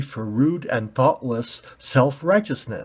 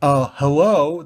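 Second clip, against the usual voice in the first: mocking, drawn hello; sarcastic hello; 4 semitones higher, 5 dB louder than usual. Red ball mocking the pointy ball, sarcastic. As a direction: knock on their head with your voice.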